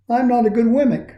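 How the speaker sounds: high, small and defeated; a hint of a sad little puppet; dejected Punchinella. A narrator's reading. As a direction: say it like he believes it. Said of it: "he would say".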